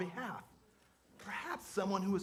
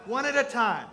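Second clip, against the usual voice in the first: shouting over crowd; 4 semitones higher, 9 dB louder than usual. Acted. Calming a noisy crowd.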